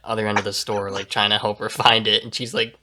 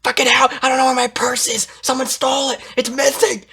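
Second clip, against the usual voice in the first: frantic shout; 14 semitones higher than usual. Retold or imitated. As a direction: wail that her purse is gone. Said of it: "she's like".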